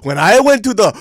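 high, loud mock voice of mom; hammed-up mom impression. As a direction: parody her bragging loudly. Mom voiced, absurd exaggeration.

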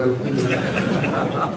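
slow, breathy, soft laughing alhamdulillah; amused mock reply. Preacher voicing the deaf visitor answering the dying patient, softly laughing. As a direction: let a quiet laugh carry the word.

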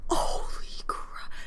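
slow, breathless whisper of shock; awed whispering; stunned whisper. Real, not acted. Stunned reaction to the picture.

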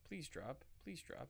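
soft, very quiet begging; pleading murmur. Real, not acted. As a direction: beg the item under your breath. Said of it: "pleading quietly with the game".

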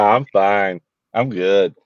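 usual speaking voice

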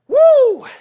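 loud, high excited woo; hype whoop. Real, not acted. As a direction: let out a big workout whoop. Coach hypes the workout.

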